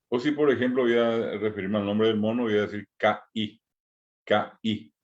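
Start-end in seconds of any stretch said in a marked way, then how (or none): 2.4-4.9 "K I" with the voice cut off between the two i's; "K I" said as ki'i with a glottal stop; glottal stop between vowels. Shown as a demonstration. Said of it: "se separa la voz".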